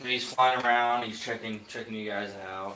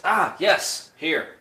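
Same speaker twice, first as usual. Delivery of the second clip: higher and louder "Ah, yes"; delighted junk dealer, pitched up loud; pleased find; 7 semitones higher, 9 dB louder, much slower than usual. Acted. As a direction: crow over the found part. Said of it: "And he goes".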